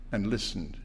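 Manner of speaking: usual speaking voice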